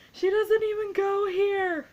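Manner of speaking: loud, high shout, drawn out; iconic movie line. Acted. Fan quoting a classic Mean Girls line, high drawn-out yell. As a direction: yell it out like the famous film moment. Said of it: "the iconic classic".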